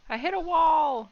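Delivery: high loud outburst; exasperated shout. Real, not acted. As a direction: yell it out, annoyed. Frustrated exclaim.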